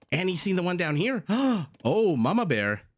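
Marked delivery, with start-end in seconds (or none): 1.3-1.8 sudden high gasp of shock; startled intake of breath; sharp gasp. Real, not acted. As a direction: gasp at the news. Caster reacts to relic count.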